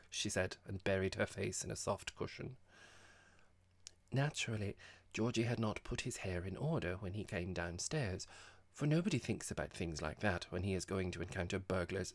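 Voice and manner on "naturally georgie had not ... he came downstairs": usual speaking voice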